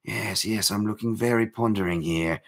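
hushed, mock-English boxer musing; soft, hammed-up British accent; terrible English accent. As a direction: put on a bad English accent, pondering. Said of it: "trying to do a terrible accent".

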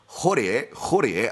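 Horie said slowly as hor-ee-ay, Scottish announcer style; slow rolled Horie, mock Scottish announcer; Scottish accent. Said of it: "as the Scottish announcer from Pancrase likes to say".

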